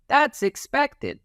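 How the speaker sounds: high, slow, sing-song "that's expected"; cheerful high approval. Shown as a demonstration. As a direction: chime it in like a cartoon helper.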